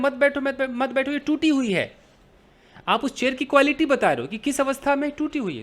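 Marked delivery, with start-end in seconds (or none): 0.0-2.0 higher, repeated mock warning; urgent warning. Host stops a guest sitting on a broken chair, urgent warning. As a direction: warn them off in a hurry.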